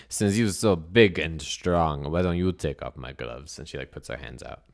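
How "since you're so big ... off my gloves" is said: put-on slow, loud accented dare; deliberate, heavy-accented taunt; taunting strong woman. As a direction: challenge him as a foreign strongwoman.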